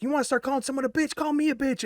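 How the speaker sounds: high, fast imitation of a feisty girl; confrontational friend. Friend stepping in against the girl, high imitated challenge. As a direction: rattle it off high, picking a fight.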